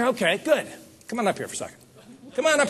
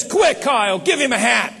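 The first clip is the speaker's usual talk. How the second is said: urgent order; 5 semitones higher, 4 dB louder than usual. Retold or imitated. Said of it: "he says".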